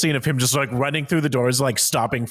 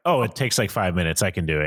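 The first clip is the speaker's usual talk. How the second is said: bored mumble; 6 semitones lower than usual. Retold or imitated. Tired tech guy retold.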